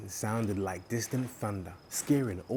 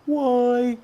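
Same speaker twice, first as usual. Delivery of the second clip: high, loud beaming exclamation; 10 dB louder than usual. Acted. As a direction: burst out brightly. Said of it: "beamed Turtle".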